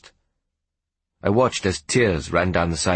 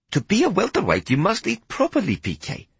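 put-on higher, coaxing Hetty tempting the boy to eat; 13 semitones higher than usual. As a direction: sweet-talk him into eating meat. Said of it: "she said coaxingly".

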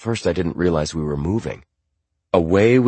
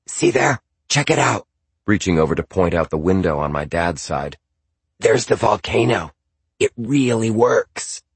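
Zandra's voice; 3 semitones higher than usual. Acted. Zandra points out sights.

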